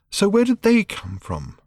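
put-on high, slow girl's question; lightly voiced young girl asking; curious teen girl. A narrator's reading. Random asks, higher voice.

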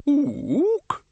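very high orangutan ook; ape hoot. Acted. Orangutan librarian speaks.

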